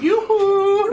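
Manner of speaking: high sing-song call. Acted. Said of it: "you yell out".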